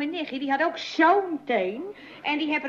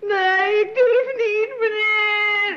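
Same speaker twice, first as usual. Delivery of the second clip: higher, louder, near tears; frightened refusal; 6 semitones higher, 7 dB louder, much slower than usual. Acted. Maid refusing the doctor, frightened and tearful. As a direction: plead in fear, voice close to crying.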